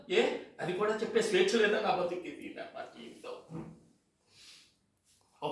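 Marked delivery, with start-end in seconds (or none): 2.3-3.3 breathy self-pitying whisper under the breath; a hushed sigh of a line; whispered aside. Acted. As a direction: mutter it to yourself, defeated. Self-pitying aside after complaint.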